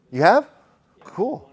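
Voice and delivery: real surprise at a student's answer; surprised delight. Real, not acted. Student has seen rare condition.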